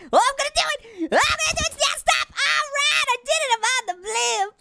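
very high, squealing celebration; excited triumph. Real, not acted. Player finally lands on the blimp, excited shouting. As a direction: shout it high as victory arrives.

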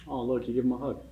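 plain reading voice

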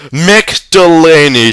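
high, loud and slow, stretching the name; wild mother screaming his name; hysterical cry. A narrator's reading. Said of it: "she cried hysterically".

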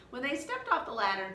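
ordinary delivery

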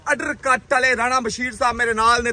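ordinary talk, nothing marked

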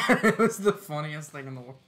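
laughing loud through the words; loud laugh-filled recollection. Real, not acted. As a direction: crack up while you say it. Laughing while recalling.

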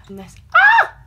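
sudden high shriek of pain. Real, not acted. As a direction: yelp as the tong burns. Burns herself with curler.

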